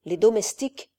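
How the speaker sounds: plain reading voice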